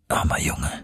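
low, soft murmur full of pity; compassionate murmur. A narrator's reading. Uncle takes his exhausted nephew in his arms, compassionate murmur. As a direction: murmur it tenderly under your breath. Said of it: "murmelte er voll Mitleid".

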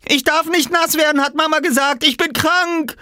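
put-on extremely high, loud child's wail; panicked protest. Small boy chased by a bigger one, panicked high cry. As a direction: plead at the very top of your voice.

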